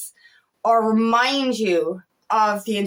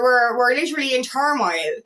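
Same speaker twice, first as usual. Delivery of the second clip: ordinary delivery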